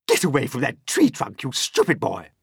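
loud scolding aunt; angry yell. A narrator's reading. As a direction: yell a warning at the boy. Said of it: "Aunt Spiker yelled".

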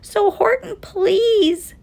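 high, drawn-out plea; pleading. A narrator's reading. Little mayor begs the elephant for help, pleading high. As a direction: beg in a tiny high voice. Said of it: "pleaded that voice of the mayor's".

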